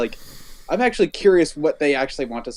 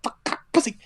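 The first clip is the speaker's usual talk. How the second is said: imitated tic outburst; 8 semitones higher than usual. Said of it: "they treat someone with Tourette's".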